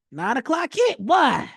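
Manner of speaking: thrilled the price finally moved; excited relief. Retold or imitated. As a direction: shout it out as the candle finally comes. Relief as the trade turns.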